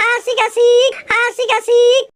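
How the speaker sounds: high giddy chant of joy; high excited cheer; gleeful cheering. Acted. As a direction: celebrate the prank, bouncing. Celebrating prank success.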